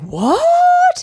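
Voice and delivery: very high, stunned What; shocked squeal. Retold or imitated. Cast members squealing in shock at the plumber line. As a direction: squeal it in disbelief.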